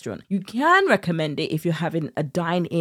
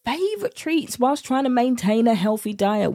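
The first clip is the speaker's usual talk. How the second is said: teaser read; 7 semitones higher than usual. Acted. Podcast cold open teaser read.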